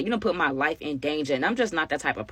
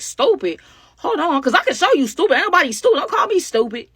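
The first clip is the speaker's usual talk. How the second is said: loud, high-pitched indignant comeback; acted-out offense; 9 semitones higher, 5 dB louder than usual. Ex snapping back at being called stupid, acted-out offense. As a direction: flare up at the insult, imitated. Acted quarrel between ex and friend.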